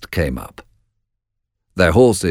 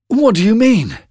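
high-pitched, baffled demand; urgent cry; 4 dB louder than usual. A narrator's reading. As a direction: cry out for an explanation. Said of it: "cried Macbeth".

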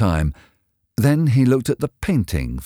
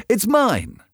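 high, possessive protest; crying out; 14 semitones higher than usual. A narrator's reading. Dorian claiming his own portrait, crying out. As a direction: claim it urgently, pitched way up. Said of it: "cried Dorian".

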